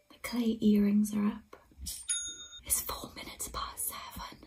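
thrilled breathless whisper. Real, not acted. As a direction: whisper the good news, stay hushed. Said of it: "I'm whispering 'cause".

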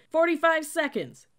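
very loud, high yell; boomed countdown. A narrator's reading. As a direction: shout the time to scrambling recruits. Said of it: "boomed the cadet".

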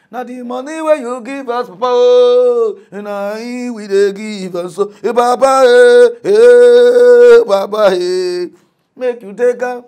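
high, loud begging, drawn out slowly; pleading woman. Retold or imitated. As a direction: beg Papa to take the money. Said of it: "Woman carry money".

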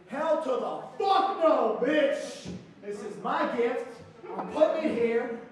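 loud, high, drawn-out refusal; mock outrage. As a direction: blurt it out, indignant and possessive. Angry thought about sharing his gift.

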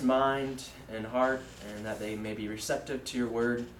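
low, subdued prayer. Real, not acted. Young preacher opens the evening with God, low prayer. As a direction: pray quietly and earnestly in a low, even voice.

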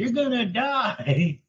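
high, very drawn-out imitated announcement; mock microphone announcement. Teasing her imitation of mom, slow high announcer-on-a-mic voice. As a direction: stretch each word as if over a megaphone. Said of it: "was she talking into a microphone when she said it".

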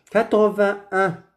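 Quatre-vingt-un with silent t before un; corrected model, Quatre-vingt-un without any et; sans « et ». Shown as a demonstration. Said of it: "Ce n'est pas quatre-vingt-et-un". (Quatre-vingt-un prononcé sans « et »)

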